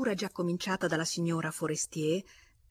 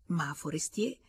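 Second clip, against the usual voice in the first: ordinary delivery, nothing marked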